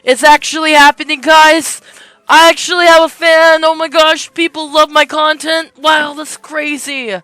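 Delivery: high, very loud fan-news freakout; ecstatic shouting. Acted. Streamer imagines telling viewers of a first fan, ecstatic shout. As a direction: shout it to everyone, overjoyed.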